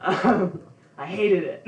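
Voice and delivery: normal talking voice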